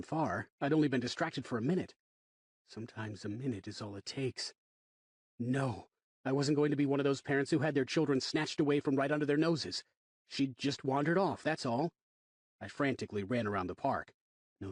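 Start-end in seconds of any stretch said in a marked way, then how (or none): none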